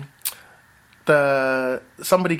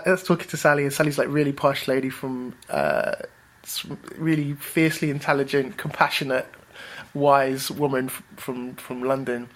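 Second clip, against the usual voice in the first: ordinary talk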